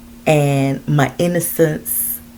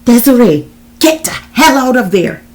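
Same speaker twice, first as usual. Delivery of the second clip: loud, raised-pitch warning shout; urgent shout; 9 semitones higher, 9 dB louder than usual. A narrator's reading. John shouting to Desiree to escape, urgent yell. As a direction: yell at her to get out.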